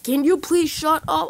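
loud, high retold yell; mock exasperated shout; exasperated gamer. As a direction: imitate a player at the end of his patience. Gamer repeats the demand, more fed up.

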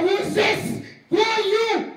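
high, forceful demand from behind a door; suspicious challenge. Acted. Jesus to the knocker at a barely opened door, suspicious.

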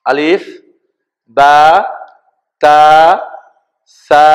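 drawn-out, level-pitched letter names; alphabet recitation. Shown as a demonstration. Teacher leading learners through the Arabic alphabet, measured recitation. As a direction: hold each Arabic letter name on a steady note.

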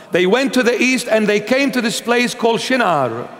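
ordinary delivery

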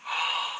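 sharp gasp of realization; sudden gasp. Real, not acted. Guesser suddenly works out the slang word, gasp. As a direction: gasp as the answer dawns.

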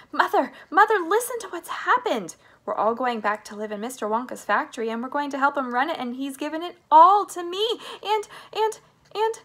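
breakneck, high, gushing excitement; excited cry. A narrator's reading. Charlie tells Mother the news, bursting with excitement. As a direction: tumble it out high and fast. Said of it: "cried Charlie".

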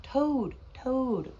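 loud, high falling calls; crying out. A narrator's reading. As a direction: call out to wake a friend. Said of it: "cried Frog".

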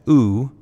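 "oo" drawn out as a long, rounded oo; long oo sound. Shown as a demonstration. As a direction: hold the vowel "oo" long and round. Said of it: "For the long U sound".

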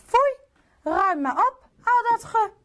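high, loud "foei"; queen ordering the king to tidy up, high and loud; stern order. Acted. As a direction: tell him off firmly.

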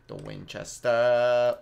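WINCHESTER stretched slowly, last syllable held out loud; drawn-out naming. Pretend. Gamer repeating the weapon name with relish, drawn-out naming. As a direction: savor Winchester, dragging out its ending.

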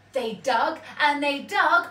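ordinary delivery, nothing marked

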